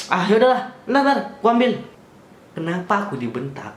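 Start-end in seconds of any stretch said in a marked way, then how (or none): 0.1-1.8 higher, impatient mock grumble; irritated cop. Officer grudgingly fetching the letter, impatient mock grumble. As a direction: give in with a huff like the officer.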